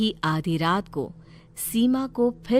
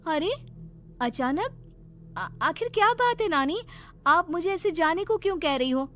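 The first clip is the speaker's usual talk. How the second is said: put-on girl's voice jumping higher in disbelief; surprised young Seema; 9 semitones higher than usual. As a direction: caught off guard, asking why. Seema shocked at being sent away.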